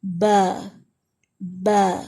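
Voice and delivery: با stretched into a long aa; با neither open nor narrow. Shown as a demonstration. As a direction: stretch با slightly upward. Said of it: "با بھی نہیں کہیں گے اور بے بھی نہیں".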